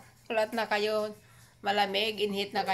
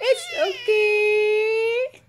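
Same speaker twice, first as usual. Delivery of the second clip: high, slow, loud soothing coo; 10 semitones higher, 5 dB louder, much slower than usual. Real, not acted. Soothing a crying baby. (mataas, mabagal na pag-aalo sa bata)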